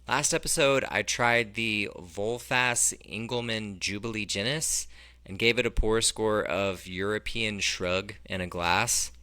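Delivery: ordinary talk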